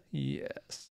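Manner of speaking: soft, sinister emperor impression; Palpatine. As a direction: imitate a quietly gloating villain watching a battle. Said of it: "Palpatine ... just being all passive, like".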